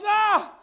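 a sharp high mock yelp; leper's yelp. Leper stood on by the girl, sharp yelp.

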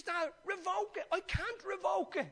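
high-pitched, urgent pleading; desperate plea. Acted. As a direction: plead and protest up high, without shouting. Acted plea between Esau and father.

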